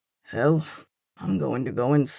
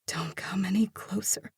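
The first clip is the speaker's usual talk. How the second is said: breathless frightened whisper; 4 semitones higher, 6 dB quieter than usual. A narrator's reading. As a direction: hush it, keep her at a distance. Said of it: "she whispers".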